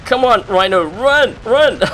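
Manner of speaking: urgent high shouts of run; frantic cheering on the mech; being chased. Real, not acted.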